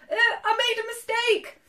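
high, loud, flustered excuse; frightened spluttering. A narrator's reading. Shaking dragon backing away from beast, spluttering. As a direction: babble excuses in a high, scared voice. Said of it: "he spluttered".

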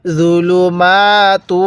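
"জুলুমাতু" slow, with long maa and tuu; measured recitation. Shown as a demonstration. Teacher showing how letters join, slow recitation. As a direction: draw out the end of জুলুমাতু. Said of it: "তা লাগবে ওয়াওয়ের সাথে".

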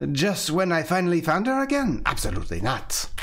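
high, hurt outrage; indignant fiancée refusing; indignant protest. Acted. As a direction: refuse him flatly. Yvette protests.

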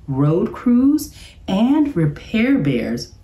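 plain reading voice

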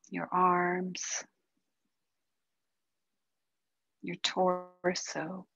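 calm, unhurried meditation guide with long pauses; guided meditation. Acted. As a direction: ease them gently into stillness, softening as it goes.

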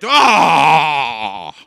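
long loud roar; drawn-out bellowed "aah"; Goliath's roar. Acted. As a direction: roar like a giant, trailing downward. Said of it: "it was something like that".

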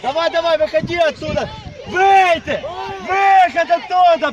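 loud, high shout from the sideline; yelling from the sidelines. Real, not acted. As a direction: bark it across the pitch. Coach yelling at a player.

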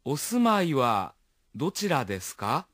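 お住まいはどちらですか? said slowly, syllable by syllable; slow and careful model for learners; slow teaching pronunciation; slow version. Shown as a demonstration. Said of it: "pronunciemos la frase después de escuchar el ejemplo".

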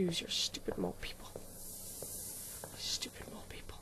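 soft whispered insult. Real, not acted. Gamer whispering insults at the mole people. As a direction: whisper the insult under your breath.

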